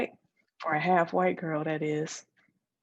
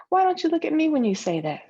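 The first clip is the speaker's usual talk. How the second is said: louder, fast, high demand; pressing challenge; 8 semitones higher, 7 dB louder, faster than usual. Acted. Friend pressing why she won't look at her, loud and fast. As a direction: push her to meet your eyes.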